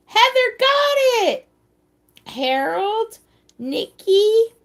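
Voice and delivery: loud, very high celebratory shout; excited cheering. Real, not acted. Host cheers players who got the answer, excited shouting. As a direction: call out the winners' names with high-pitched glee.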